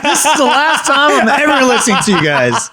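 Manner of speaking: high, loud, laughing through the words; laughing mock quote. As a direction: laugh while playing the fed-up friend. Listener voices friend's exasperation.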